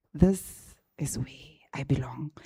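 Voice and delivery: hushed, reverent whisper to God; whispered in awe; awed whisper. Retold or imitated. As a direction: breathe it like a prayer. Whispered dream in the office.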